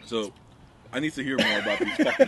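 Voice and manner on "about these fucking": laughing while talking; words breaking into a laugh. Real, not acted.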